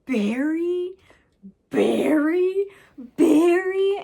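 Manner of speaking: building disbelief, rising to a shout; mock outrage growing with every Barry; incredulous. [name] stunned at Ruth's boyfriend.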